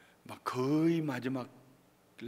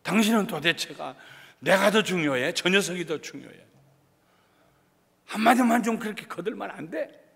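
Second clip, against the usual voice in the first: loud, raised-pitch acted reproach; upset husband; 6 semitones higher, 10 dB louder than usual. Husband demanding that his wife take his side, indignant. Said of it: "남편은 더 속상해가지고 막 더 그냥 까무러치고".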